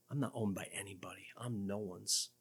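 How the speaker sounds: hushed, quick, lightly voiced defiant unbeliever; scornful non-believer. Preacher voicing the unbeliever who rejects God's ownership, hushed defiance. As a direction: toss it off as the proud unbeliever, softly and fast. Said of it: "To the non-believer".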